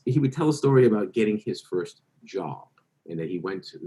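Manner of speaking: ordinary delivery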